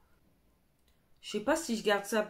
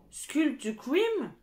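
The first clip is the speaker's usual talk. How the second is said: usual speaking voice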